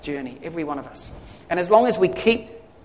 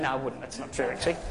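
quiet aside; 6 semitones lower, 14 dB quieter, faster than usual. Real, not acted. Joking aside retracting the lawsuit.

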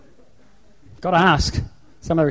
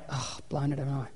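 much softer aside to oneself; muted self-reproach; 16 dB quieter than usual. Acted. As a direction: drop the volume, owning a small mistake. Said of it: "And I go".

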